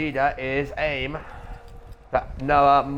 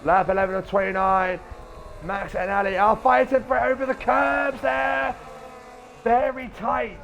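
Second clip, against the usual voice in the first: race caller; 9 semitones higher than usual. Real, not acted. Live race commentary.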